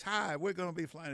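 smooth, calm pilot over the intercom; easy captain's cabin announcement; airline pilot announcement. Retold or imitated. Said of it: "when the pilot comes on, it's always".

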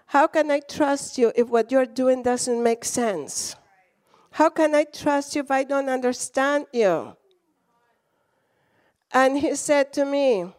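ordinary talk

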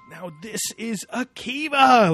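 mock-excited announcement, high, loud and drawn out; slow, swelling burst of imitated wonder; excited TV host. Co-host mimicking the presenter hunkering down in the pit, all excited. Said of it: "Like, he's all excited".

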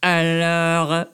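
drawn-out fake French; mock French accent. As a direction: put on a hammy French accent. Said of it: "With a terrible accent".

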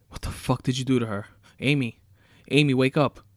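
yelled. A narrator's reading. Tied-up husband yelling at his wife-captor, loud panicked shout. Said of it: "He yelled".

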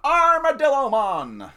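loud, high, drawn-out name call; hammed-up character call. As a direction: stretch the name like a big announcement.

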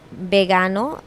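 ordinary talk, nothing marked

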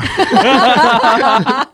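high burst of laughter; loud laugh. Real, not acted. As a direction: crack up at the punchline. Laughs at punchline. (放声大笑)

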